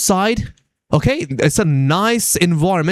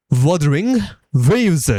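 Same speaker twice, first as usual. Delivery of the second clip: Wuthering Waves drawn out slowly; slow repetition; slower than usual. Retold or imitated. Gamer repeating the game's name to a confused child, slowly. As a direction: spell out WUTHERING WAVES at teaching speed.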